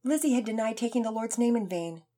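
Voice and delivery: ordinary delivery, nothing marked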